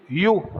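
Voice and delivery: You said as a clear "iu"; you pronounced. Shown as a demonstration. Teacher models You for students, a clear "iu". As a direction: say You like "iu", one clean syllable. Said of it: "o U com o som de U".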